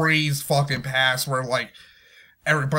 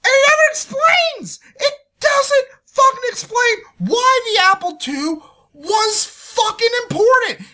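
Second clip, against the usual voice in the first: high, loud, furious shouting; real outraged ranting; angry rant; 13 semitones higher, 4 dB louder than usual. Real, not acted. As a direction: yell it out in disbelief. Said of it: "but I'm so fucking angry".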